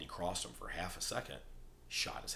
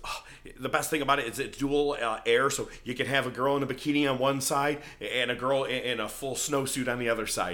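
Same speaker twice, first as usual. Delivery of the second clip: louder, higher mock-eager boast; mock bragging; 5 semitones higher, 8 dB louder than usual. Dork bragging about his dual air to Nacho, mock-eager. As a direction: imitate an over-keen show-off.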